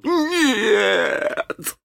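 high, terrified pirate; terrified refusal. Acted. Chako refuses again, high and terrified. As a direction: refuse with a shudder. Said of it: "завопил он".

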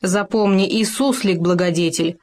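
ordinary delivery

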